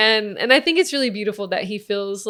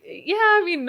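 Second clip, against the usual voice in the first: much higher, giggling through the words; laughing reply; 12 semitones higher, 5 dB louder, slower than usual. Retold or imitated. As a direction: try to answer while cracking up. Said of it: "And I was like".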